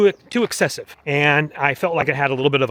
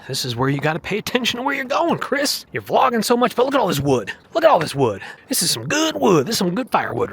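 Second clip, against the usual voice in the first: playful high voice; 7 semitones higher than usual. Acted. High playful voice teasing Chris.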